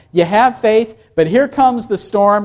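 ordinary talk

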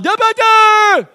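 loud, high, slurred holler; drawn-out yell with the words mangled; garbled yell. Retold or imitated. Dizzy rider yelling to the operator, garbled yell. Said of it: "yelled out".

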